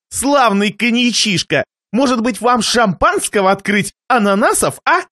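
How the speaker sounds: высокий голос, передразнивающий гостя; повтори похвалу повыше, с издёвкой; язвительный хозяин. Acted. Хозяин едко вторит гостю. (ехидно-любезный хозяин)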